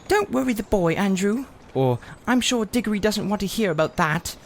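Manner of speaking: high, prim aunt's voice; shutting the old man up, primly; Aunt Letty. Retold or imitated. Diggory imitating Aunt Letty hushing Uncle Andrew, prim. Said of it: "She always shuts him up".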